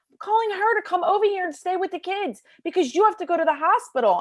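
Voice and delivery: fast, high and rushed; hint of a frantic husband. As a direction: rattle it off in a panic. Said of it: "he starts screaming".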